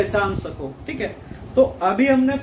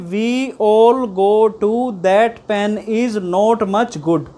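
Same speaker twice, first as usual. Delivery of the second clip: slow recital; 6 dB louder, much slower than usual. Shown as a demonstration. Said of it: "मेरी वो video देखी होगी".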